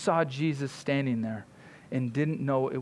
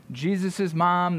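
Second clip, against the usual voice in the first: usual speaking voice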